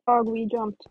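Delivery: usual speaking voice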